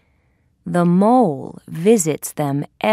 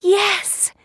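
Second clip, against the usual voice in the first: high-pitched heroine exclamation; excited cry; 12 semitones higher than usual. A narrator's reading. Thumbelina accepting the bird's rescue, high excited cry. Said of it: "cries Thumbelina".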